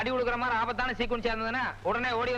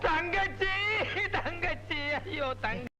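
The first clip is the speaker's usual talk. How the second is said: put-on wail, pitch a little raised; fake crying; 6 semitones higher, much slower than usual. Young man fakes tears for the sister to get near the girl. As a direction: pretend to sob over a lost sister. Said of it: "அழாதப்பா, அழாத".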